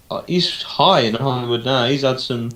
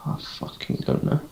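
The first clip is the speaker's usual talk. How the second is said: frustrated whisper; 9 dB quieter than usual. Real, not acted. Frustrated at not remembering actor.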